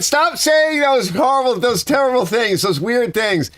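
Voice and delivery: overacted cry of frustration; mock pleading. Telling the friend to stop his awful pitch, mock plea.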